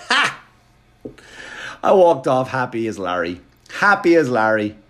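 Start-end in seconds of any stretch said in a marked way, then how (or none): 0.1-0.4 triumphant ha. Retold or imitated. Triumphant laugh after taunt.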